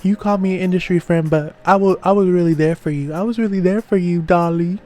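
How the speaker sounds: a hint of a hurt friend's retort; imitating Summer. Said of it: "Summer states".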